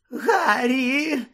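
high, tearful squeal; overcome, high-pitched gasp; gasping Pettigrew. Acted. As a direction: cry out gratefully in a thin whimper. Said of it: "видихнув Петігру".

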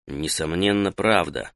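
low, soft aside; inward verdict. A narrator's reading. Taita silently confirms the captive's answer, low aside.

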